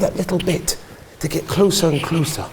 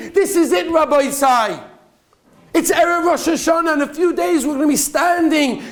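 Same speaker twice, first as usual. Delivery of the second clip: fiery preaching; 11 semitones higher than usual. Acted. Rabbi urging congregation before Rosh Hashanah, impassioned preaching. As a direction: preach it out to the whole room at full force.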